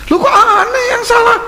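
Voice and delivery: a man protesting to the ustaz that he is not the one at fault; indignant protest. Retold or imitated. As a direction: throw the question back in disbelief.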